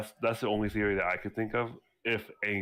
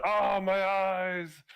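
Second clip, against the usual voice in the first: high, slow, drawn-out mock wail; mock cry of pain; 11 semitones higher, much slower than usual. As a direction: ham up the pain, stretched high. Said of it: "he's like".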